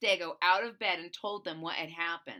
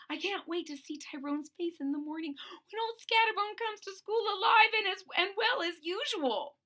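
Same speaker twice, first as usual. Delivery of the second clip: gleeful chuckle voice; 10 semitones higher than usual. Acted. Gleeful friend.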